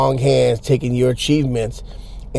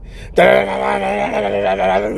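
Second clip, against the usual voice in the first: rapid high "tanana" vocal riff; mimicked guitar riff; 6 semitones higher than usual. Guy riffing at the kids, mouthed guitar noise. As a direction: imitate shredding guitar with your mouth.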